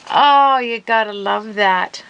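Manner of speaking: loud, high oh sliding down; amused exasperation. Real, not acted. Crafter reacts to her spill, amused exasperation. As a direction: laugh off the mishap loudly. Laughing at her own mishap.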